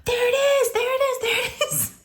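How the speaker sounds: very high, thin imitated scream; mock scream. Daughter spotting the mouse, screaming. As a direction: squeal it like a screaming kid, without full volume. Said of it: "Lou starts screaming".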